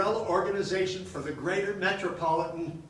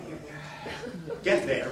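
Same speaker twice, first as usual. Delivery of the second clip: ordinary delivery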